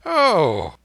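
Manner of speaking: high, loud "Oh" of surprise; sudden loud start; loud exclamation. A narrator's reading. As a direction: exclaim it loudly, pitch jumping up. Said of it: "said he, quite aloud".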